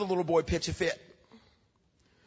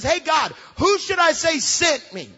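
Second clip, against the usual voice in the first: Moses asking God; 7 semitones higher, 4 dB louder, slower than usual. Retold or imitated.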